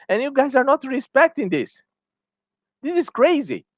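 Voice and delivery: high, disbelieving this is crazy; exasperated outburst. Real, not acted. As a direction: pitch jumps way up, incredulous. Ranting at developers over nerf.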